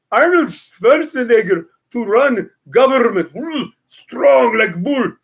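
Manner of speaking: put-on slow, heavy Austrian strongman accent; mock Arnold, strong like bull; Schwarzenegger voice. Host mocking Schwarzenegger with Austrian accent.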